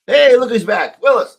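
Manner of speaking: loud, high, cheerful welcome back; greeting the co-host who just rejoined, loud and bright; excited greeting. Real, not acted. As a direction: call out happily to a returning friend. Greeting returning co-host loudly.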